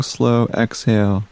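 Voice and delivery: usual speaking voice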